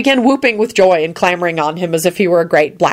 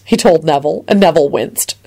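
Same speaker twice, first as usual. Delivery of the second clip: plain reading voice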